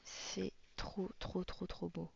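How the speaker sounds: quiet, wonder-struck murmur; awed murmur. Real, not acted. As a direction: let the words fall to a hush. Awed murmur over a drawing.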